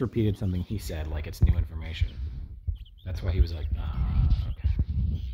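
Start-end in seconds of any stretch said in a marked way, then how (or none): 3.8-5.2 breathy whispered reply; mock hushed answer. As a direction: whisper it like a sheepish robot. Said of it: "Surely that's what he sounds like".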